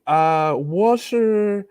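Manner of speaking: put-on very slow, halting Chinese in a heavy American accent; broken Chinese, thick accent. Feigning he barely speaks Chinese to the officers, slow thick American-accented Chinese. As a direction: drag each syllable out like a lost beginner. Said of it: "really broken Chinese".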